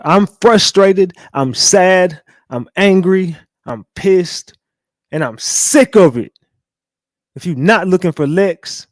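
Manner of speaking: high, loud hammed-up read of an angry message; mock frustrated rant. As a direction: overplay her frustration, slow. Reads Trina's angry message dramatically.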